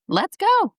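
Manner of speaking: high, excited rallying cry; retold burst of enthusiasm; eager yes. Retells her eager reply to kids.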